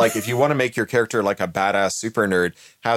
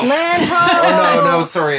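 shouting from crow's nest; 7 dB louder, much slower than usual. Real, not acted. Mistakes clouds for island.

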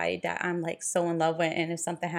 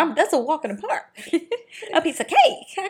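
laughing brag; 8 semitones higher, 4 dB louder than usual. Real, not acted.

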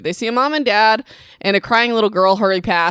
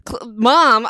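usual speaking voice

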